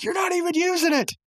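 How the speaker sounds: very high squealed complaint; exasperated protest. Acted. Mordecai turning round on Muscle Man, high mock protest. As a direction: protest in a squeaky put-on pitch.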